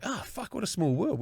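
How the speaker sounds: high gasp of recognition; surprised. Retold or imitated. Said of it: "and I was like".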